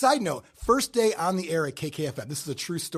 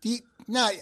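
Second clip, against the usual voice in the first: ordinary talk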